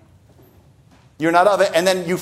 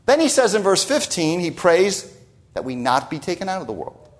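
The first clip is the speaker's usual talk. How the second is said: ordinary talk, nothing marked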